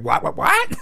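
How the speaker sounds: high-pitched, incredulous squawk; mock shriek of disbelief; shocked mom. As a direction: imitate a stunned mom, pitch shooting way up. Mom reacts to the found money.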